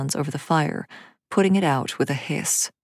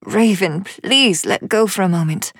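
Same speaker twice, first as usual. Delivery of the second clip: louder harassed plea; harassed mother; 3 semitones higher, 5 dB louder than usual. A narrator's reading. Mother to the child clinging to her skirts, harassed. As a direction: plead a little louder, harassed.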